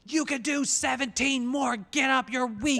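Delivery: high, loud mock trainer bellow; drill-sergeant yelling. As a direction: yell it like an overbearing personal trainer. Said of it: "why are you yelling".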